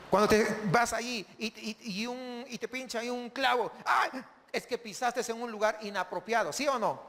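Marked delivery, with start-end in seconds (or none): 3.9-4.2 mock yelp. Stepping on a nail.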